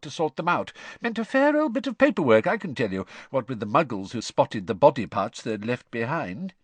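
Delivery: lively, confiding explanation; earnest explaining father. A narrator's reading. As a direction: warn the listeners as a knowing dad. Story figure, father explaining.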